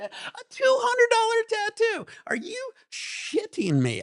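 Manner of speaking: high, slow, outraged disbelief; incredulous, stretched-out complaint; exasperated rant. Real, not acted. Ranting about tattoo price.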